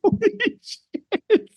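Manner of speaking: high, slow laughing amazement; laughing awe. Retold or imitated. Fan laughing in awe at the performance. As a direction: gasp it out through laughter. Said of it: "I was like".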